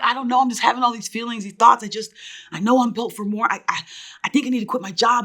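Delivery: high-pitched, run-on retold confession; retold upset outpouring. Daughter unloads on mom over the phone, retold distress. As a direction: pour it out, imitating being upset. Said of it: "I'm crying on the phone".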